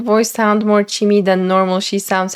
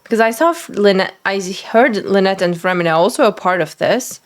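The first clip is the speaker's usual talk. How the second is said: normal talking voice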